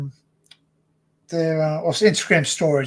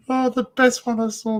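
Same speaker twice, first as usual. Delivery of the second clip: normal talking voice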